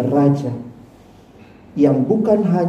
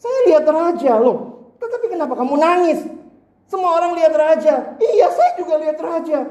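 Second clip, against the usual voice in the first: put-on childlike voice; 10 semitones higher than usual. Crying child answers teacher.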